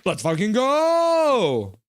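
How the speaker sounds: high, loud, slow drawn-out cheer; all-out celebration yell; triumphant shout. Real, not acted. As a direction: roar it like your team just scored. Goal celebration.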